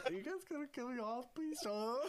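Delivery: lightly voiced little kid, quick and pleading; a hint of a scared child asking; mock small kid begging not to be cut. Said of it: "Sounded like a little kid".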